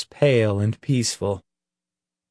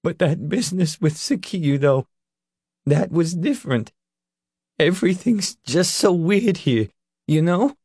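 shaky and tearful; 6 semitones higher than usual. A narrator's reading. Leroy shaken, confessing.

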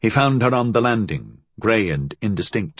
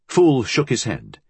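ordinary delivery

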